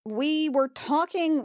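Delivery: ordinary delivery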